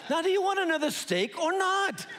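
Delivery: high, exasperated mock demand; stern, almost angry. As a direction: press for an answer, pitch jumping up. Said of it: "almost angry face and said".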